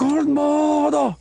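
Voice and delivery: high, slow, drawn-out shout of the name; villain name call. Retold or imitated. Cohost nails the villain's name call in a high, slow voice. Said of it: "There we go".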